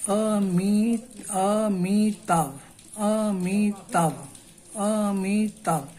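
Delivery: Amitabh stretched out slowly, each syllable apart; teacher breaks Amitabh slowly into syllables for students. Shown as a demonstration. Slowly spelling out the syllables. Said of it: "you can pronounce trisyllabic words very easily".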